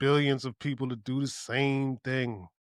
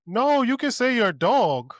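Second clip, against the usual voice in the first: high, loud mock correction; mock objection; 9 semitones higher, 6 dB louder than usual. Objectors correcting the would-be god, high mock protest. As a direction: overrule them in a raised, louder voice.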